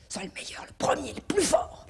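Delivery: hushed coaxing egging on; urging whisper. Acted. A parent whispering ambition into the child's ear. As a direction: whisper it as a push to be the best.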